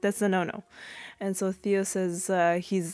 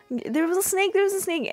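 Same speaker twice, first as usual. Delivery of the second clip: put-on scared child; 12 semitones higher than usual. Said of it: "she's just like".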